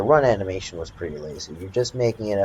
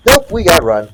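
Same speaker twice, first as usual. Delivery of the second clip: urgent; 7 semitones higher, 7 dB louder than usual. Real, not acted. Fleeing the dinosaur again.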